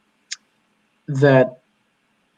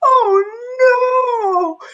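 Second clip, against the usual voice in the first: put-on much higher wailing toddler voice, crying; imitating crying toddler; 3 dB louder than usual. Dad imitating his toddler daughter, high crying wail. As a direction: squeal it like a distressed little girl. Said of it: "and she was like".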